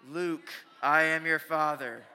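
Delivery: slow, loud, booming villain declaration; famous movie-villain quote. Retold or imitated. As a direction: intone it like the famous film line. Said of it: "I had heard the quote, the famous quote".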